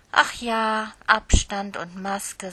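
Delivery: ordinary delivery, nothing marked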